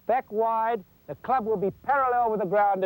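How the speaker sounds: ordinary talk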